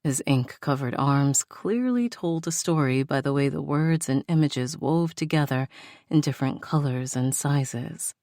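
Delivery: usual speaking voice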